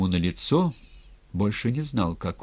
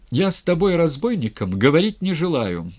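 proud, higher and louder outburst; angry shout; 6 semitones higher, 5 dB louder than usual. A narrator's reading. Captured governor to rebel and townsmen, angry shout. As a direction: cry out in indignant defiance. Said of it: "крикнул он".

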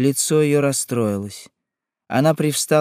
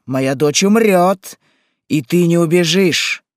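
loud, raised-voice tirade; furious refusal; 5 semitones higher, 6 dB louder than usual. A narrator's reading. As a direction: rage at the one you blame. Frantic mother threatens the queen. (гневная громкая тирада)

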